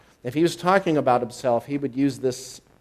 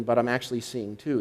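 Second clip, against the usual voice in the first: ordinary talk, nothing marked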